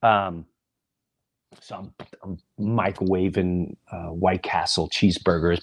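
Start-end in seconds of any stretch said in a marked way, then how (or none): none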